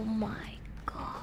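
slow, soft, shaky whisper; whispered dread. Real, not acted. Player facing gruesome enemies, slow fearful whisper. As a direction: breathe it out in dread.

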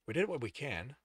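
ordinary delivery

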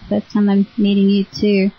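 ordinary talk

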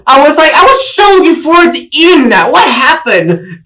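half-laughing mock dismay; laughing, indignant. Laughing retelling of missing food.

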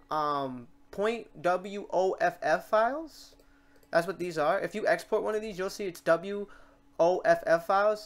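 usual speaking voice

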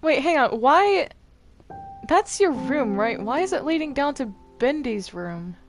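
ordinary talk

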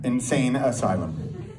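ordinary delivery

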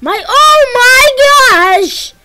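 very slow, high, loud exclamation; drawn-out shriek. Real, not acted. Gamer shrieks at the boss fight, slow, high and loud. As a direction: stretch it into a long shriek.

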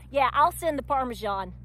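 normal talking voice

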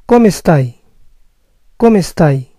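¿Cómo estáis? said as Italian "KO-me STAI"; Italian come stai, falling. Shown as a demonstration. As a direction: ask it like an Italian. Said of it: "¿Cómo estás?".

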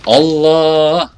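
long, high, loud exclamation; alarmed cry. Real, not acted. Player about to be killed, alarmed yell. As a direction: cry out under sudden fire.

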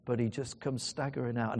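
ordinary talk, nothing marked

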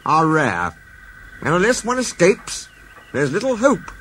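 a boss breaking hard news; gravely. Acted. The Fat Controller tells the engines real ones are rare, gravely. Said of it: "said the Fat Controller gravely".

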